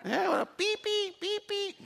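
put-on high, timid little-boy voice; boy at school asking to pee, high and shy; child asking for bathroom. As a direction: plead softly like a kid who can't speak English.

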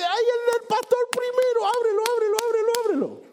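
very high, excited mother pleading; mother's eager voice. Retold or imitated. The boy's mother urging the pastor to open the gift, high excited squeal.

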